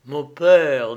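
«père» stretched and pressed hard, loud with a falling tune; slow, weighing each syllable. A narrator's reading. Son to his father, weighing each syllable. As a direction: lean on every syllable of «Mon père». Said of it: "dit-il lentement, en pesant sur chaque syllabe".

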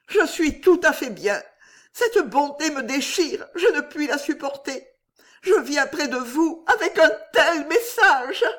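high voice, near breaking; troubled friend bearing bad news; distressed young woman. A narrator's reading.

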